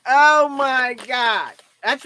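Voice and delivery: very slow, high, loud laughing exclamation; howling at the news; drawn-out laughing cry. Real, not acted. Overcome with laughter.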